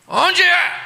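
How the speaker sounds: loud high barked question; gruff father. Retold or imitated. Strict father questioning his wife, loud. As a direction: demand it like a suspicious dad.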